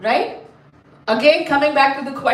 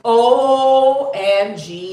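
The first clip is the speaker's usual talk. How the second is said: very slow, stretched-out letters; dramatic dread; 4 dB louder, much slower than usual. Acted. Storyteller telling children how angry mother got, drawn-out dramatic. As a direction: draw out each letter for effect.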